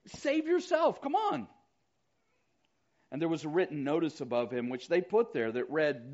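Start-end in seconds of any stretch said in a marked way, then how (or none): 0.1-1.5 high jeering challenge; mocking taunt. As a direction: taunt him to come down. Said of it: "They mocked him".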